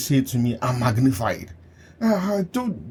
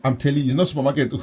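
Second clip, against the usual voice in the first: ordinary talk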